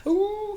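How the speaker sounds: high, thin mock wolf howl; werewolf howl. Shown as a demonstration. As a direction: tip the head back and howl like a werewolf. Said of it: "So when you do it, it's kinda like".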